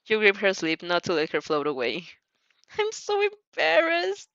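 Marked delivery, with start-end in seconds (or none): 2.8-4.3 high, slow, squirming embarrassment; embarrassed squeal. Acted. Shy Uraraka to her friends, embarrassed and high. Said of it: "distressed squeals".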